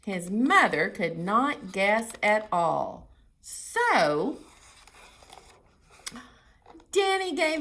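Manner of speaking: loud, lively read-aloud voice; story reading. Acted. Reading the story's ending to children, lively.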